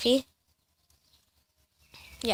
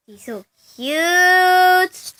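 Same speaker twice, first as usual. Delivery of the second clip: slow, high gushing coo; 6 semitones higher, 8 dB louder, much slower than usual. Real, not acted. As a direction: draw out the cuteness. Cooing over the cats.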